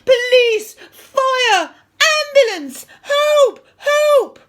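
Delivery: high, full-volume scream for help; panicked scream. A narrator's reading. Teacher racing off after the supposed fall, panicked scream. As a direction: scream for rescue. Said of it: "screamed Mister Nurden".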